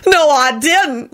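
slow, high grumbled denial; grumbling. A narrator's reading. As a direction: grumble it, dragging the words. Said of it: "Lucretia grumbled".

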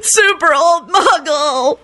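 high lament ending in drawn-out howl; wail, then stretch the last words into a howl; wail to keening howl. A narrator's reading. Kenny mourns his muggle friend, keening. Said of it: "finished with a keening howl".

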